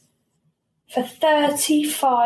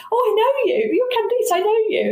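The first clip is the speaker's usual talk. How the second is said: high, gushing mock voice; high falling imitation, each phrase dropping; imitating the lady; 13 semitones higher than usual. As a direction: play the lady recognising her, high and delighted. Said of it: "She's like".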